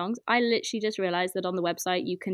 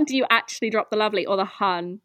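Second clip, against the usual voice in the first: usual speaking voice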